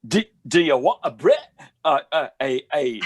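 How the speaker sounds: exaggerated Cockney, jumping high at the end; Cockney accent. Showing friends his British dialects, Cockney accent. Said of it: "Cockney accent".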